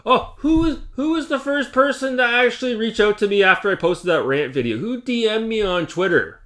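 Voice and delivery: high, lightly mocking put-on voice of a gloating woman; mocking imitation. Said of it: "she was crying on camera".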